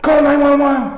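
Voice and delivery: high urgent shout for help; frantic call. Retold or imitated. Husband panicking over bleeding wife, high shout. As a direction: yell for someone to call. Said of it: "And then I was like".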